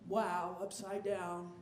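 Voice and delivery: ordinary talk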